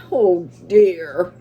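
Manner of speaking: a weary Santa sighs oh dear; sighing. A narrator's reading. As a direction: let it out on a sigh. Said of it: "And he sighed".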